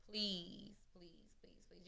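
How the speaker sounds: soft, breathy begging please; pleading whisper. Real, not acted. Pleading softly with listeners to lean on friends. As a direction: beg them in a hushed voice.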